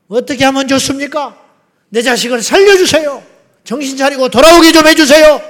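high, loud begging that builds; desperate plea. Retold or imitated. Parent begging Jesus to bring a lost child home, loud pleading. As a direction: cry out to Jesus for your runaway child.

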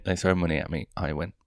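ordinary talk

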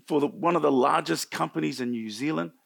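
ordinary talk, nothing marked

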